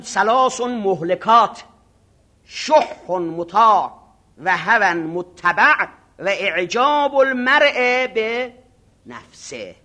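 slow, solemn Arabic recitation; hadith recitation. Acted. Preacher reciting the Prophet's words to the seminary, slow and solemn. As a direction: intone the hadith slowly.

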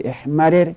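احمرر as "ehmarir"; unmerged form. Shown as a demonstration.

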